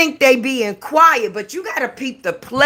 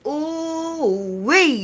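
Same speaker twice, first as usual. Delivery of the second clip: drawn-out ooh wee; 3 semitones higher, 4 dB quieter than usual. Real, not acted. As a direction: stretch the ooh wee slowly, thrilled. Drawn-out exclamation about hot news.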